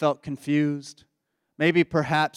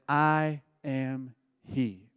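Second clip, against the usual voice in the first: slow and soft, each word weighed; hushed, slow revelation; solemn quiet reveal; 2 semitones lower, 6 dB quieter, much slower than usual. Retold or imitated. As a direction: let it land gently and slowly. Jesus reveals himself as Messiah.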